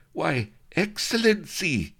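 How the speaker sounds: high, slow yipping toady. Acted. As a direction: yip it like a lapdog. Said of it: "he yipped".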